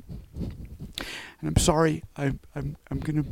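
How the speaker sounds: fighting back tears mid-sermon; choked up. Real, not acted. Preacher to congregation, voice breaking with emotion. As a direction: voice catching, slow and soft. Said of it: "I'm gonna be emotional today".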